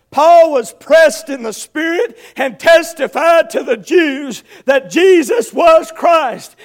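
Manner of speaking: high, loud pulpit appeal; full-force preaching. Real, not acted. Preacher urging sinner friend to be born again, full-force preaching.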